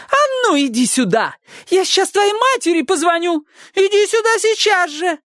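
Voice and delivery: yelling neighbour. A narrator's reading. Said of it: "вопила миссис Фонтейн".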